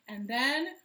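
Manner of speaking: usual speaking voice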